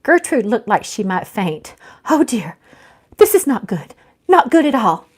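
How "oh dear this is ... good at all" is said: rising high, loud fretting; flustered high fretting. Acted. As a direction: panic like an old lady about to faint. Gertrude about to faint.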